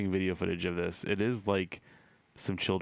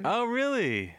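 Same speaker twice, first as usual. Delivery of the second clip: surprised; 4 dB louder, slower than usual. Real, not acted. Mock surprised reaction.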